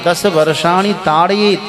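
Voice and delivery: scripture recitation. Acted. Recites Sanskrit verse.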